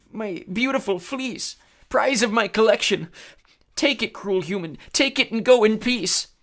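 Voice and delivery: high, slow mournful giant voice; sorrowful cyclops. A narrator's reading. Defeated Cyclops surrendering the fleece, mournful. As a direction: grieve over the prize.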